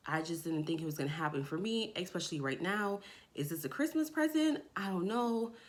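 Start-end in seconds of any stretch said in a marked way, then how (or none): 3.3-5.5 high-pitched, bewildered questioning; retold confusion. Woman recalling learning she was pregnant, confused. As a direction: ask it high, mildly thrown. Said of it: "Just all the way confused".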